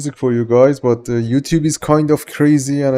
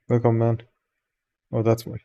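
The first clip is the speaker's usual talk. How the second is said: usual speaking voice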